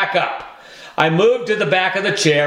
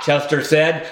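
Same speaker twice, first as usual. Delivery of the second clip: ordinary delivery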